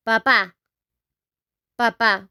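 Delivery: papá with the final á stressed, pa-PA; stress on the last A. Shown as a demonstration. Teacher showing where the accent falls, stressed final syllable. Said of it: "with an accent in the A".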